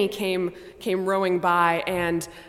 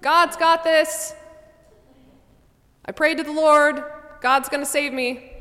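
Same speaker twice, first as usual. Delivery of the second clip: yelling refusal; 9 semitones higher, 5 dB louder than usual. Acted. Pete yells to helicopter crew, loud and high. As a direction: call up stubbornly.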